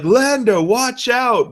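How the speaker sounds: shrill-pitched hammed-up call of warning; mock the cheesy movie warning yell; high warning cry. Mocking the uncle's warning cry.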